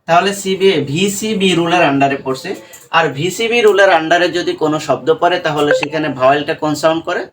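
ordinary delivery

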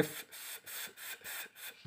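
ف- breathed out with no voice; voiceless f demo; voiceless f sound. Shown as a demonstration. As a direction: blow ف- through teeth on lip, no voice. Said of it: "وأنت بتقول F".

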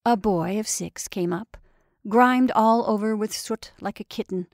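plain reading voice

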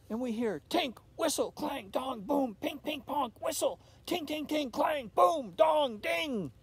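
high, loud imitated clanging instruments; noisy band sounds. A narrator's reading. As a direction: bang out the noises like a toy band. Narrator imitates band noises.

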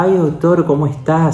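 agudo, imitando a la enfermera que adula al doctor; enfermera zalamera imitada. Retold or imitated. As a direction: remeda su dulzura falsa con los doctores. Mocks nurse sweet to doctors. (burla de la enfermera empalagosa con los cirujanos)

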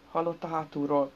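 ordinary delivery, nothing marked

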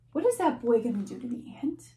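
usual speaking voice

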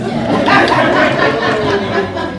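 high, loud delighted laughter; laughing with delight; excited laughing. Real, not acted. Delighted reaction to curry poem.

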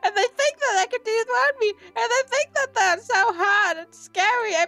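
high, wailing fake sobs; a vlogger sobs to a game cow for a hug, mock crying. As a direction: wail and whimper like a heartbroken child. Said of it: "even though I'm crying".

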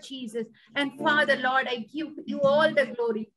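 ordinary delivery

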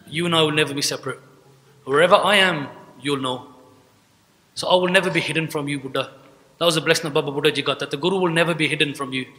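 ordinary talk, nothing marked

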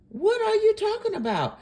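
high, indignant challenge; incredulous repeat. Real, not acted. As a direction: repeat it in high-pitched disbelief. Repeats challenge, much higher.

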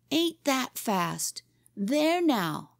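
high, slow, crowing triumph; triumphant shout. A narrator's reading. Boy shows off after pedaling back, triumphant. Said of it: "he shouted triumphantly".

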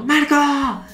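high, drawn-out cartoon call; dramatic yell. Star calls Marco across the crowd, high dramatic yell. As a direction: yell his name across the school. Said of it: "va por Marco y grita".

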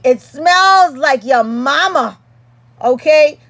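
drawn-out, punchy sass; indignant comeback. Acted. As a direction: snap back in mock outrage. Mock-angry retort to critic.

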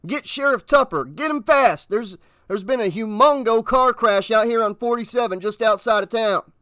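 high-pitched, agitated officer; urgent radio report. Acted. Officer reporting a car crash to dispatch, urgent. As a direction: blurt out the emergency, pitched well up.

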